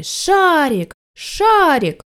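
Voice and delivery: high, long falling calls; children calling out. A narrator's reading. Children run past shouting a name, high calling. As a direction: call the name high and stretched. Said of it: "кричали".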